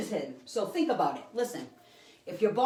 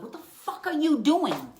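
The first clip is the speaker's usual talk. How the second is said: higher, louder, slowed-down furious demand; retold angry yell; 8 semitones higher, 5 dB louder, slower than usual. Psychic replays the woman confronting him, angry yell. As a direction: yell it at him as she charges.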